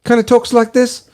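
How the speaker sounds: New Zealand accent, pitched well up; Kiwi accent. Shown as a demonstration. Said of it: "he's got the Kiwi accent".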